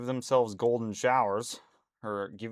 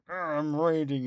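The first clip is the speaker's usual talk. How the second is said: mock raging gamer; 5 semitones higher, 4 dB louder than usual. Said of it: "a skit. He was like".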